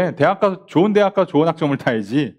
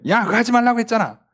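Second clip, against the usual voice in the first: high-pitched telling-off; acted parental scolding; 6 semitones higher than usual. Parent telling off their children, high-pitched scolding. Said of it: "자식들한테 막 호통을 해요".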